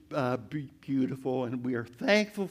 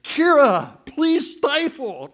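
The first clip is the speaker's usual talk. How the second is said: louder, higher, slow mock reprimand; mock scolding; 8 semitones higher, 9 dB louder, slower than usual. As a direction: hiss it at the giggling daughter, hammed-up. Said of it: "I said to Kira, or I thought it".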